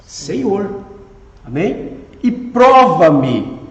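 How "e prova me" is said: "E prova-me" slow, PRO hit hard; "prova-me" drawn out with a strong first syllable; stress on PRO. Shown as a demonstration. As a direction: lean heavily on the "pro" of "prova-me". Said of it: "No pró".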